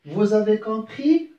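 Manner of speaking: rising question tune on compris; question intonation. Shown as a demonstration. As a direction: let the voice climb on "compris?" to make it a question. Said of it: "avec l'intonation de la voix".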